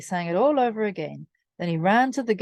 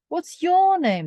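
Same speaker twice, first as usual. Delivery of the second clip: cheeky mouse; 10 semitones higher, 4 dB louder, slower than usual. A narrator's reading. Cheeky mouse asks name.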